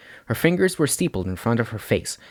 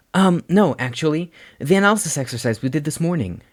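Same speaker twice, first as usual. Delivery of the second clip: put-on higher, hesitant boy; nervous boy voice; 7 semitones higher than usual. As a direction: hedge it shyly. Izuku clarifies.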